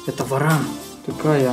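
ordinary talk

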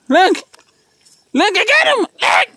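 high thrilled shout; thrilled to have caught it; excited cry. Real, not acted. Excited catching animal.

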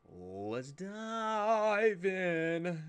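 long, stretched words climbing high; drawn-out kickoff. Real, not acted. Host launching the reaction video, drawn-out rising kickoff.

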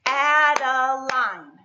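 Adeline chanted slow and loud in three beats; syllable by syllable. Shown as a demonstration. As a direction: clap out Adeline as A-de-line, stretched and loud. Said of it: "Are we ready to clap?".

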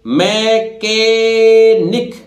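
drawn-out teaching "मैकेनिक"; careful pronunciation. Shown as a demonstration. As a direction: model "मैकेनिक" slowly, k for ch. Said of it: "इस तरह देखिए पढ़िए".

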